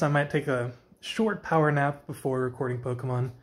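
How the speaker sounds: ordinary talk, nothing marked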